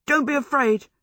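high, unsteady attempt to reassure; shaky reassurance. A narrator's reading. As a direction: reassure while sounding scared yourself. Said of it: "he said shakily".

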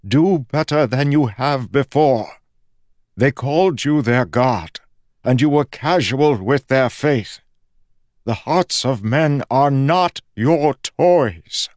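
a god scolding his champion; strengthening god's voice. Acted. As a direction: build into a rebuke. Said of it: "his voice strengthening".

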